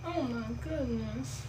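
breathy, soft sexy voice; mocking a sultry voice. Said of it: "That's her sexy voice".

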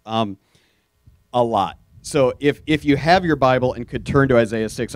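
usual speaking voice

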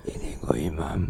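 put-on whispered, frail old man; very old Imam. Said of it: "Ini yang tua banget ya". (suara kakek renta berbisik)

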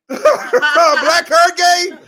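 high, slow, laughing through the question; cracking up while asking it again. Real, not acted.

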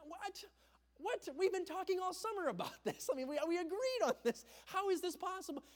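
high, stunned disbelief with a laugh; can't believe what mom just said; incredulous protest. Retold or imitated. Son to mom after being told to wait, stunned high disbelief. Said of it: "And I'm thinking".

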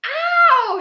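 loud, high-pitched yelp; shriek of pain. A narrator's reading. Older brother with a suitcase on his foot, loud high shriek. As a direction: shriek as if hurt. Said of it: "shrieked his older brother".

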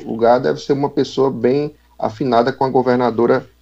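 normal talking voice

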